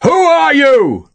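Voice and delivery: long drawn-out, high-pitched bellow. A narrator's reading. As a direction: hail a distant house, stretching the words slowly. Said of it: "Sharp bellowed at the farmhouse".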